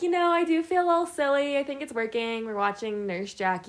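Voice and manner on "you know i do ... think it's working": higher, fast giggly quote; giddy. Retold or imitated. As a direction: rattle it off, a bit giddy. Quoting herself feeling silly.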